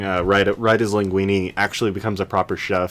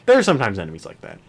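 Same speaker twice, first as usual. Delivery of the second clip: usual speaking voice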